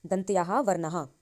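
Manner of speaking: ordinary delivery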